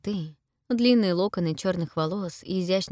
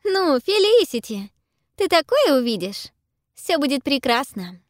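flirtatious; 8 semitones higher, 6 dB louder than usual. A narrator's reading. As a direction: tease her playfully. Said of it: "кокетливо усмехается".

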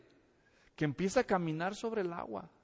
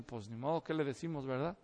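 resigned mutter; 7 semitones lower, 9 dB quieter than usual. Retold or imitated. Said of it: "como diciendo".

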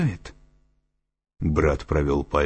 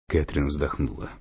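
ordinary delivery

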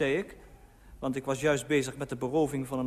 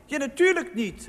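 high, indignant retort; calling back; 10 semitones higher, 4 dB louder than usual. A narrator's reading. Husband answers wife's question, high called retort. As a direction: throw it back in a raised voice. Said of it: "riep ik".

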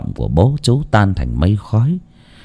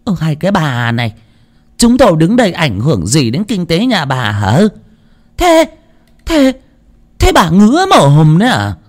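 angry retort; 8 semitones higher than usual. Acted. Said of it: "chỉ tay vào mặt của bà Dân mà quát".

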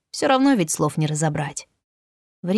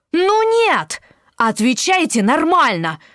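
indignant outburst; 6 semitones higher than usual. Acted. Jumps up, demands proper answer.

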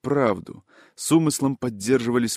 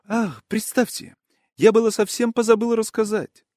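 high, animated character voice, bursting with news; eager, high-voiced gossip; suddenly remembering; 10 semitones higher than usual. A narrator's reading. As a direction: pitch it way up, as if suddenly recalling something fascinating. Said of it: "как будто припомнив что-то весьма интересное".

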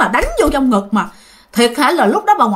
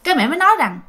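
ordinary talk

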